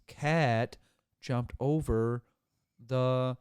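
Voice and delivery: loud, word by word with gaps; slow, over-enunciated. Shown as a demonstration. Speaker enunciating for someone who missed it, slow and over-enunciated. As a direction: spell it out one slow word at a time. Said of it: "slow down and enunciate every word".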